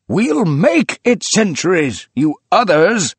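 high, loud, slow rallying cry; fierce cry. A narrator's reading. Old man rallying the group, high loud fierce. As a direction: fire them up with fierce resolve.

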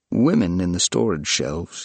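usual speaking voice

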